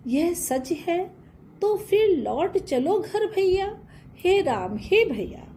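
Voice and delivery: high pleading plea, slowing on the first words; pleading Kaikeyi. Pretend. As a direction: beg Ram to come home. (ऊँची आवाज़ में विनती)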